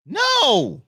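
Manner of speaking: loud, high refusal; squealed no to the collar; high squeaky no. Real, not acted. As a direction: yelp it in mock panic.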